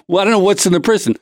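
ordinary talk

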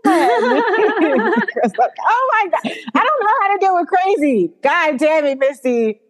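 high, slow, laughing oh my God; mimicking a character overwhelmed by Misty, high and laughing; laughing exasperation. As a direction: laugh through a mock breakdown. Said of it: "She's like".

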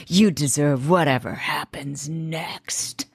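slow cold whisper; menacing whisper. Retold or imitated. Chuck warning Elizabeth, a slow cold whisper. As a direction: hiss the threat slowly under the breath.

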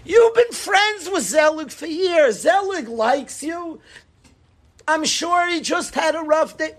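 high, insistent parental reasoning; acted parent brushing off a child's anger; answer-giving father. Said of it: "you start giving answers to your kid".

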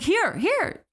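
frantic attendant bargaining with the hijacker; frantic offer. Retold or imitated. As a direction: talk fast to talk a man out of a bomb. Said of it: "and says, like".

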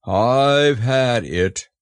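very slow, drawn-out donkey voice; doleful drone; gloomy Eeyore. A narrator's reading. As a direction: drag it out with heavy resignation. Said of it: "said Eeyore".

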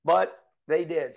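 ordinary talk

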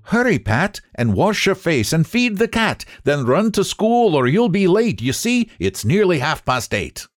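fussy Dame Potato hurrying Pat; bustling mother. Acted. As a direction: hurry the child off to school. Dame Potato hurries her son.

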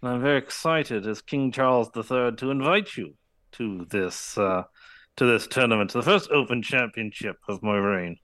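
put-on a light King Charles British accent, teeth kept closed. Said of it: "The key to doing a King Charles impersonation".

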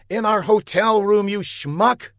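high, loud through gritted teeth; angry shout. A narrator's reading. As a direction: snap at him in fury. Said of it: "gritting her teeth".

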